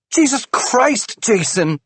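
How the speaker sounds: high, loud, slow furious yell at her husband. A narrator's reading. Wife yells at husband after his confession, furious. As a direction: scream his full name in rage. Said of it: "she yells".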